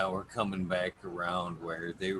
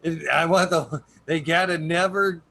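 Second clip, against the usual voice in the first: excited; 6 semitones higher, 8 dB louder than usual. Real, not acted. Excited pitch for a show.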